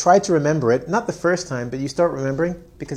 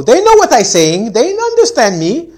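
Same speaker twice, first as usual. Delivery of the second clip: much higher, loud, slow protest; mock stubborn student; 9 semitones higher, 12 dB louder, slower than usual. Student insisting classmates understand, hammed-up high protest. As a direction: whine it like a student who won't budge. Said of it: "Remember, this is the not to do thing".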